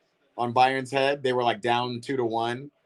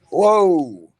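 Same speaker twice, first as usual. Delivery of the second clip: startled whoa; 5 semitones higher, 10 dB louder than usual. Real, not acted. Camera suddenly slips.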